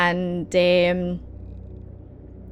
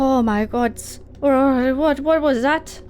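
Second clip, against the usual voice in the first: higher, stammering alarm; shaken character; 5 semitones higher than usual. Acted. Zolog getting up after the demon goat, shaken. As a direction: stumble over the words in fright.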